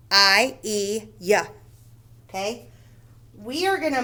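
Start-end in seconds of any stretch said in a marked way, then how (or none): 0.1-1.5 "I", "E", "y" as separate, firm sounds; three letter sounds. Shown as a demonstration. Teacher showing three sounds of Y, "I, E, y" sounded separately. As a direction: sound out long "I", long "E", then "y". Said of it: "Y can make three different sounds".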